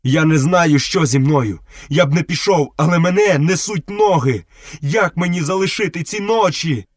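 high, anguished plea with no real sobs; acted tearful distress. A narrator's reading. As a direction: pitch rising in mock-weeping despair. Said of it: "і заридав".

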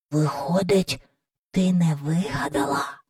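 put-on soft, half-whispered child's wonder; hushed whisper. A narrator's reading. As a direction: ask it under your breath, very softly. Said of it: "прошепотів Майкл".

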